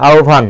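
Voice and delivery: আহ্বান as "ao-bhan"; demonstrated pronunciation. Shown as a demonstration. Teacher confirming the answer, আহ্বান pronounced "ao-bhan", demonstrative. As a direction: sound out আহ্বান with bh for the written b. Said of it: "এটা হচ্ছে উচ্চারণ।".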